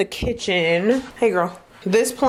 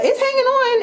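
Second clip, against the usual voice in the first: much higher, squeaky cheer for the plant; hopeful squeak; 3 dB louder than usual. Real, not acted. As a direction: lift it into a tiny proud squeak.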